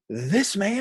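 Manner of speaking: high, drawn-up proclamation; emphatic praise. Retold or imitated. Stranger presenting the storyteller to others, emphatic high proclamation. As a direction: announce him to everyone, pitched high.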